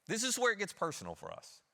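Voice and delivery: normal talking voice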